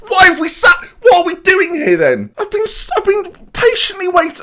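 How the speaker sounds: high, loud outraged shouting; furious, cracking exasperation; incredulous shout. Real, not acted. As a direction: yell in disbelief, voice cracking. Opponent misses winning penalty.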